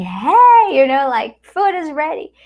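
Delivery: high, loud call that lunch is ready; calling her husband from another room, loud and high; shouting at husband. Retold or imitated. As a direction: holler across the house to him. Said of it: "I was shouting at him".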